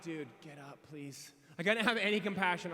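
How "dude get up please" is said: soft, lower, unimpressed plea; quiet plea. Retold or imitated. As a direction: mutter it at the guy on the ground. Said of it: "I was like".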